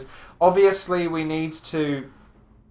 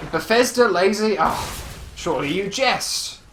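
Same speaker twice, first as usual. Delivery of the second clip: mock indignation; 6 semitones higher, 3 dB louder than usual. Mock outrage at Bethesda.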